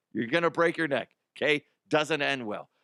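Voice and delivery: ordinary talk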